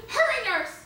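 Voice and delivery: loud, high, mock-emergency shout; urgent command. Doctor ordering a nurse to bring an instrument, urgently. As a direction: call out as if mid-operation.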